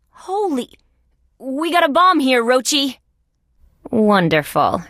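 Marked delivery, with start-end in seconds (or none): 0.1-3.0 high-pitched, hushed start into urgent warning; alarmed. Acted. Officer finding a bomb and telling partner Rochi, alarmed.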